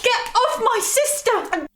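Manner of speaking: high, slow shout to make him stop; slow, high shouted order. Acted. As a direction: yell it out, desperate to stop him. Fiancé attacking her sister.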